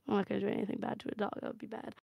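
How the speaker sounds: fast, hushed aside; quiet aside. Real, not acted. Narrator mutters a quick aside to the players, hushed. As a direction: rattle off an aside under your breath. Aside about not hurting dogs.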